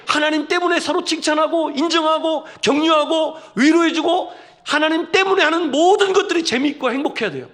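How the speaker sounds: preacher swelling to a shout; rising preaching fervor. Real, not acted. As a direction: build each word toward a ringing climax. Preacher building to climax.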